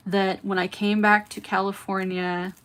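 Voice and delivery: usual speaking voice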